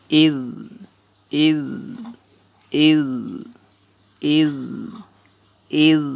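"idh" with a held, voiced dhal; "idh" repeated singly, with pauses; drilled "idh". Shown as a demonstration. Teacher shows alif zer dhal jazm, drilling "idh". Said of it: "আলিফের জের জালের জজম".

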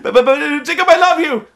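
high, stammered mock confession; imitating frantic last-ditch plea; Bella desperate. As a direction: blurt it out in hammed-up desperation. Bella desperately stops Jacob.

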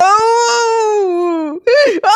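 loud put-on wail of pain; hammed-up pained wail to fool the mother-in-law; fake wailing. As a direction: cry out to fake a headache. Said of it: "அழாத மருமகளே".

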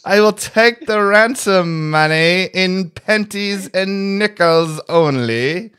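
Austin Powers villain. Pretend. Friends riff on Austin Powers as a hammy villain. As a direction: play it like a campy sixties mastermind demanding ransom. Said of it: "Mister Powers".

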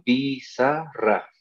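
slow "pizarra" as pi, za, rra; syllable by syllable. Shown as a demonstration. Teacher says it goes by pronunciation, "pizarra" split slowly. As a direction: set the syllables of "pizarra" apart, falling at the end. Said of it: "eso es por pronunciación".

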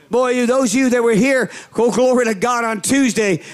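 ordinary delivery

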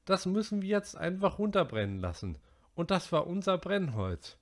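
put-on high, worried boy's voice. A narrator's reading. Narrator voicing Piggy to the boys, high and worried. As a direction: voice the anxious fat boy.

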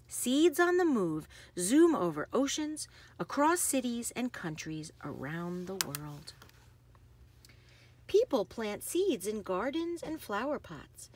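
lively, sing-song picture-book read; bouncy storybook narration with playful sound words; reading to children. Acted. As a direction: read it like bedtime story time for little kids. Reads picture book aloud.

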